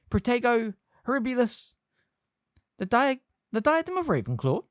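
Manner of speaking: high, squeaky little charms master; squeaky Flitwick. A narrator's reading. Flitwick squeaks at Harry in surprise, high squeaky voice. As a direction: squeak it out like a tiny professor. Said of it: "squeaked Flitwick".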